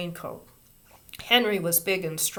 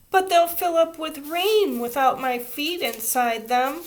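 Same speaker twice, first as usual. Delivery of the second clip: put-on higher, louder child's protest; 8 semitones higher, 6 dB louder than usual. A narrator's reading. Little girl protesting to her teacher. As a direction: protest with worry. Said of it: "protested Ramona".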